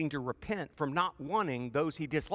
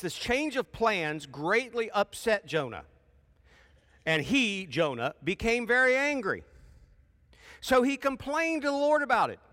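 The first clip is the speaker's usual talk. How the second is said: high, loud preaching read; preacher building up through the Bible passage; fervent scripture reading; 8 semitones higher, 5 dB louder than usual. Acted. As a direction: read the story with rising fire. Preacher reads scripture aloud.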